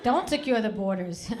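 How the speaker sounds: sudden loud comic objection; loud protest. Acted. Comic reversing the slogan for a housekeeper, loud protest. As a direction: burst out against it at full volume.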